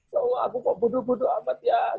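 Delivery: put-on high, dismayed inner voice of a scared child; child's self-blame. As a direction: wail at yourself as the ashamed kid.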